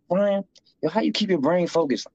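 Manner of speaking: a lightly voiced curious fellow inmate; curious inmate friend. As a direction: voice pitched well up, asking eagerly. Friend voiced in a prison story.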